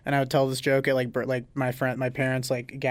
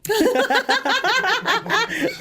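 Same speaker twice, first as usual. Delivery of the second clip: squealing giggle; high-pitched laugh. Real, not acted. Laughing hard at the joke.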